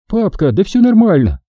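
put-on a young girl, cheerful; girl's voice. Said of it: "улыбнулась девчонка".

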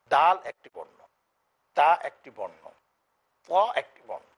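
দাল, তা, ত each said loud and distinct as a letter name; letter sounds shown. Shown as a demonstration. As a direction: hit দাল, তা and ত clearly one by one. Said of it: "দেখুন". (প্রতিটি বর্ণ স্পষ্ট জোরে উচ্চারণ)

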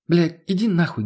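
lightly voiced woman snapping; snapping back. A narrator's reading. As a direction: bite back with a touch of anger. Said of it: "огрызнулась в ответ".

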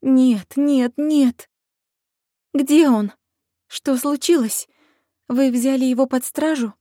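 high, loud, frightened questions; trembling voice. Acted. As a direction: ask in shaking panic. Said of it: "спросила я дрожащим голосом".

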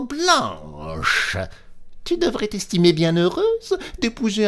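very high squeaky mouse scolding. A narrator's reading. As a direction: nag her like a bossy little mouse. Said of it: "s'écria la souris". (souris grondeuse à la voix très aiguë)

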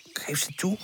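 usual speaking voice

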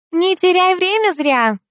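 high, slow calling out. A narrator's reading. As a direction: call it out high, stretching the words. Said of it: "уже громче сказала Морозова".

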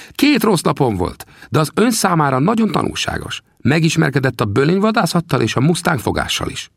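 put-on high, teasing little old man's voice; old trapper Sam. Sam teasingly asks the narrator about his bones, high old voice. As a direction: needle the greenhorn from a high perch. Sam's voice in dialogue.

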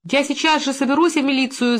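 restrained high-pitched yell; shouting from a room. A narrator's reading. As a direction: call out a threat from the next room. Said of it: "закричала она из комнаты".

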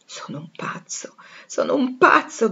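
a slow, breathless whisper that swells on the repeat; anguished whisper. Acted. Rochester breaking down before Jane, slow anguished whisper. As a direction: hiss it out in despair, the second time stronger. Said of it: "gridò ad un tratto il signor Rochester".

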